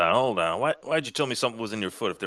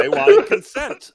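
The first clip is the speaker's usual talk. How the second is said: squeaky high; 10 dB louder than usual. Acted.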